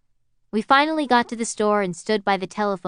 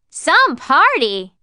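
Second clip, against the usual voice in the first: long, slow yell, higher and louder; 8 semitones higher, 8 dB louder, much slower than usual. Acted. Girl yelling across the street at the narrator, slow mocking yell. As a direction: holler it across the street, drawn out. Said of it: "she yelled".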